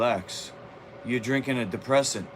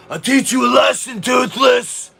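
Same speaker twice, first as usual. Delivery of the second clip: high, loud battle-cry shout; sudden roaring shout; 12 semitones higher, 11 dB louder than usual. Real, not acted. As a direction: bellow the threat at the top of your lungs. Taunting a viewer in chat.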